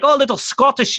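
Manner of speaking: quick demo of Scottish; lightly imitated Scottish; Scottish accent. Shown as a demonstration. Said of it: "do it like that".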